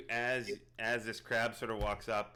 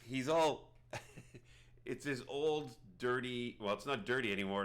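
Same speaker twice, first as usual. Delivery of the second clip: normal talking voice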